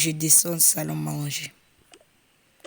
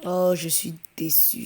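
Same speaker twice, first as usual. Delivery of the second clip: normal talking voice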